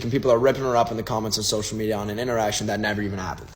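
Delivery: ordinary talk, nothing marked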